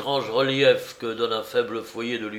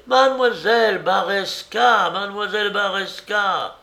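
insistent calling; 9 semitones higher, 6 dB louder than usual. A narrator's reading. Searchers getting no answer at the door, insistent calling. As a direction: keep calling to someone behind a door.

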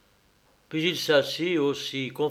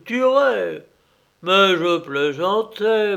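raised, louder coaxing apology; pleading surveyor; 4 semitones higher, 7 dB louder than usual. Acted. Surveyor to the hiding coachman, anxious louder coaxing. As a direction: talk him back out of the woods. Surveyor coaxes Klim back.

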